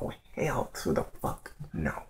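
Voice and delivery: low, soft, breathy disbelief; an inner voice barely aloud; hushed inner thought. Retold or imitated. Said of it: "I thought".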